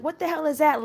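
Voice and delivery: high-pitched incredulous demand; retold alarm. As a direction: act out freaking out at the device. Retelling her alarmed reaction to device.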